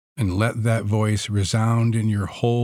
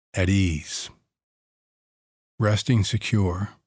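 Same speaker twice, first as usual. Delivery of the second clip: guided meditation. Acted.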